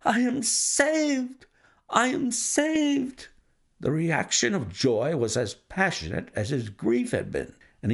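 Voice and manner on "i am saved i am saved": high, drawn-out cries of relief; rescued from ruin, overflowing; slow, high ecstatic relief; joyous cry. A narrator's reading. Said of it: "With a shriek of joy".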